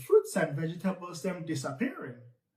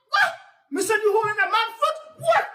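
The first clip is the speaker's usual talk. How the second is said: startled outrage; 14 semitones higher, 5 dB louder, faster than usual. Acted. Nancy surprised, stuck.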